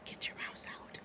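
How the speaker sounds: whispered instruction. Real, not acted. Whispered aside to class.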